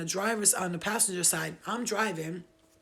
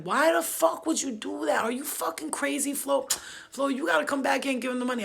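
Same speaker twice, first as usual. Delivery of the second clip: retold angry yell; 8 semitones higher, 5 dB louder than usual. Brother yelling on the phone.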